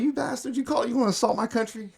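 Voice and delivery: high-pitched mock outrage; retold indignant outburst, voice shooting way up; losing it. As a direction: act out losing your temper in a high, hurried voice. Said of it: "So I, I lost it".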